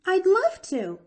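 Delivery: high, loud eager yes; eager exclamation. A narrator's reading. Girl exclaiming to the doctor who invited her, high and loud. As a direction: burst out with delight. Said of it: "exclaimed Pollyanna".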